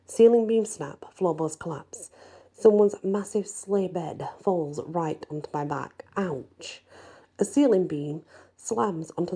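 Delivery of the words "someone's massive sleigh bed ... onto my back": plain reading voice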